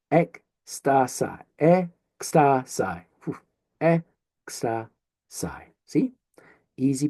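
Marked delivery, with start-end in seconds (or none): 0.1-2.8 "Ecstasy" slowly in separate pieces, ek-sta-sai; syllable by syllable. Shown as a demonstration. Teacher works through a Greek word, "Ecstasy" slowly syllable by syllable. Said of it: "that's where we'll syllabify". 3.8-5.6 "Ecstasy" very slowly, e-ksta-sai, long gaps; syllable by syllable. Shown as a demonstration. Teacher finishes the split, "Ecstasy" very slowly in three pieces. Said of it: "that's where we'll syllabify".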